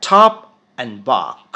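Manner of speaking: English pair Top and bark; model pronunciation. Shown as a demonstration. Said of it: "প্রনাউন্সিয়েশনটা কিন্তু সম্পূর্ণ আলাদা".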